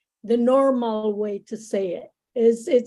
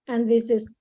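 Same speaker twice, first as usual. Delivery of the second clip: ordinary talk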